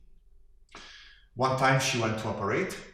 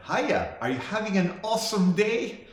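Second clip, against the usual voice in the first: imitating an over-eager server, high and rising; high-pitched customer-service greeting, lifting on the question; chirpy counter girl; 9 semitones higher, 3 dB louder than usual. Counter girl greeting a customer at Jack in the Box, chirpy. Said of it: "the girl behind the counter said".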